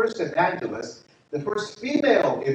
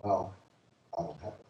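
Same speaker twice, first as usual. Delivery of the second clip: quiet admission; 7 semitones lower, 8 dB quieter than usual. Retold or imitated. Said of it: "And she goes".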